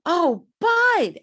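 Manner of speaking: high, loud retold cry of recognition; delighted recognition. Woman greeting her late cousin Bud, delighted cry. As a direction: call out in joyful surprise.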